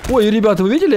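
sudden high startled cry; startled yelp. Real, not acted. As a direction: jump at the scare. Jump scare in horror game.

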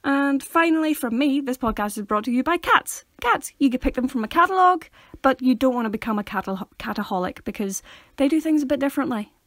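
lively, emphatic announcer; mock sponsor read. Host wrapping up with cats as sponsor, mock ad read. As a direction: sell the silly sponsor with a flourish.

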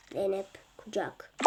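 put-on creepy film child. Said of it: "Sen kucak demezdin Çınar".

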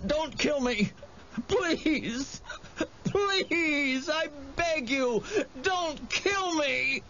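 very high, tearful, drawn-out pleading; begging for mercy. A narrator's reading. Wendell on his knees before the monster, begging in terror. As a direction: wail and plead for your life. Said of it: "begging for mercy".

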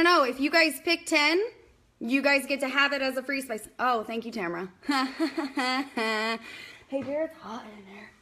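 usual speaking voice

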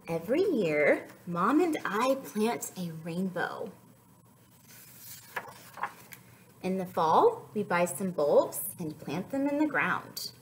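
slow, gentle storybook reading; picture book read aloud; reading to children. Acted. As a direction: read it like story time for toddlers.

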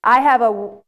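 ordinary talk

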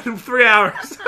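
usual speaking voice

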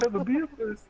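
high-pitched mock bloke voice; imitated bloke. Acting out the bloke wanting a beer, high mock voice. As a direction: squeeze it high, as the retold character. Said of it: "he's like".

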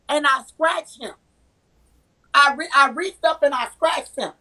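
usual speaking voice

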